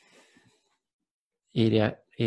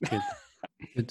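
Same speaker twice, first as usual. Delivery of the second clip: laughing; 9 dB quieter than usual. Real, not acted.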